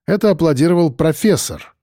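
ordinary delivery

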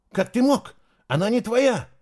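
high, loud outraged cry; furious. A narrator's reading. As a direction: shout it, outraged and hurt. Heroine furious at guard. (возмущённо, на крике)

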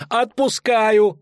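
high, stretched-out howl. Acted. Dandelion howling forgiveness at the block, drawn-out wail. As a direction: wail it out in panic. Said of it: "взвыл Лютик".

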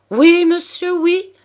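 put-on the old man crying out to the judge to stop. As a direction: shout the warning to stop him. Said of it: "je vous crie".

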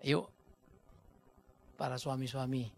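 ordinary talk, nothing marked

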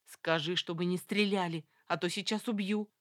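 usual speaking voice

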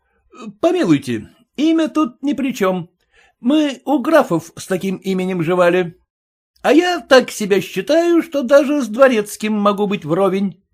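a hint of a fussy lackey. A narrator's reading. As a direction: play the self-assured servant defending his name. Lackey's pleading voice.

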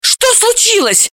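very high, drawn-out alarmed question; frightened high cry; panicked. A narrator's reading. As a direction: ask in sudden panic. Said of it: "запаниковала Далила".

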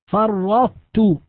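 فرطت slowly, the ta doubled with the emphasis of the ta kept; partial assimilation demo. Shown as a demonstration. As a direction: stretch فرطت and keep the heavy ta colour. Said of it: "فمثلًا كلمة". (نطق فرطت ببطء مع بقاء الاستعلاء)

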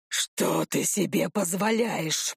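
low, slow rumbling growl; low rumble. A narrator's reading. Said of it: "пророкотал Вит".

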